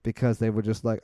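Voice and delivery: usual speaking voice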